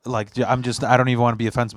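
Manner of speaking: ordinary delivery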